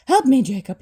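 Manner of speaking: loud frightened begging. Acted. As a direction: beg the ghost to save you. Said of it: "he begged".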